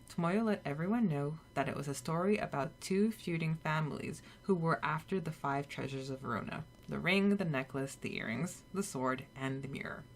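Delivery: usual speaking voice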